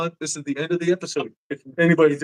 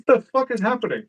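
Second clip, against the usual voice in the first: high-pitched startled quote; playfully acted bewilderment; mock alarm; 7 semitones higher, faster than usual. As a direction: imitate a spooked, baffled reaction. Retells scared cats' reaction.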